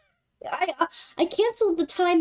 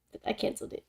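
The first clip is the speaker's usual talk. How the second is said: whispered aside; 4 semitones lower, 11 dB quieter, much faster than usual. Real, not acted. Whispered admission.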